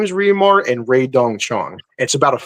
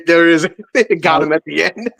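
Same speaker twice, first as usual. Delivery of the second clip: ordinary talk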